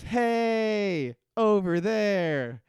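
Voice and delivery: loud, high, drawn-out holler; calling out. Retold or imitated. Hurley spots a boat coming in, calling out. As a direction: holler it out to someone far off, stretching the words.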